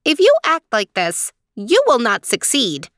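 high-pitched mouse giving a warning. A narrator's reading. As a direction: warn her in a tiny, high voice. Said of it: "The mouse said".

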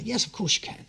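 high, soft refined voice; a hint of a posh gentleman; quoting his friend Rob as a well-spoken man. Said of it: "He's a very well-spoken man".

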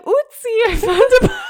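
mock hiker. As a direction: squeal it out, breaking into laughter. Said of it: "Do you think they're like".

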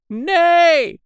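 very high, loud cry of refusal; shout at full voice. A narrator's reading. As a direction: shout it at the top of your voice. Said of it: "shouted the curate at the top of his voice".

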